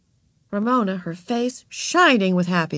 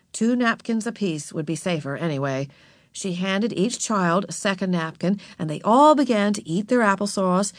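usual speaking voice

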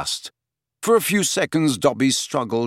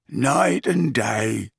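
croaking elf; much slower than usual. Acted. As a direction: drag the words out like a weary old elf. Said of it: "croaked Creature".

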